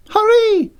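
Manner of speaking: very high, loud urgent shout; urgent call. Acted. Siobhan calling from the bedroom, high urgent shout. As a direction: hurry him along from the bedroom.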